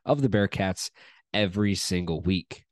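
upbeat host opening the show, starting loud; podcast intro. Acted. Host welcoming listeners to the Bearcats podcast, upbeat announcer read.

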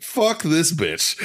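high, drawn-out mock indignation; retold outrage. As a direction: stretch it out like an offended friend. Buddy's angry reaction, retold.

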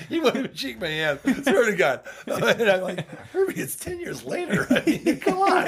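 talking through laughter, high; laughing. Real, not acted. Telling friends about Herbie's snub, laughing and high. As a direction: laugh while pleading.

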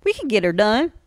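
loud Texas twang; Texas accent. Shown as a demonstration. Co-host showing off for actor, confident Texas accent. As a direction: play up a hearty Texas accent. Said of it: "Let's, let's talk with a Texas accent".

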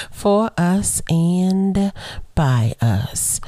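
slowed, stretched repeat; deliberate slow reprise; slow again. Shown as a demonstration. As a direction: lean into each word slowly. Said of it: "Why she say that part so slow?".

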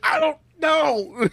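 high, drawn-out, loud wail of confusion; hammed-up bewildered cry; mock exasperation. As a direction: stretch it out in helpless mock panic. Retold exasperated reply, hammed up.

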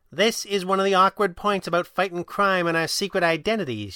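high sing-song lecture; sing-song scolding. A narrator's reading. Kit lectures the Red Panda on secret identities in scolding sing-song. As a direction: scold him like a small, dull child. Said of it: "she said in a sing-song tone".

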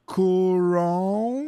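Coron stretched very slowly; slow mock name. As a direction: drag out Coron like a slow announcer. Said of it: "agora fala bem devagar".

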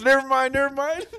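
high, loud comic panic; retold panicked backtracking. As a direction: take it back in a mimicked yelp. Boastful driver backtracks after crash.

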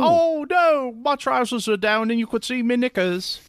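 high, startled mock British lady; lightly hammed-up high British voice. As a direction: fluster like a prim lady caught out.

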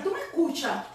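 anguished plea to God. Retold or imitated. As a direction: call up to heaven in distress. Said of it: "verdaderamente yo estaba llorando".

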